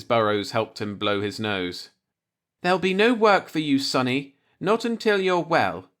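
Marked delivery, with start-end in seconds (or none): 2.6-5.9 motherly landlady. A narrator's reading. Landlady fussing over sick boarder.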